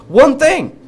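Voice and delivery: exasperated burst; emphatic exclamation. Real, not acted. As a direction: slam the point home. Emphatic point in rant.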